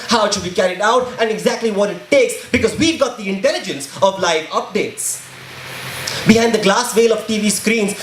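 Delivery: spoken-word poem. Acted. Poet accusing those who want blood, impassioned spoken word. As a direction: perform it as a driving, emphatic protest poem.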